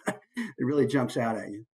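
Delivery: normal talking voice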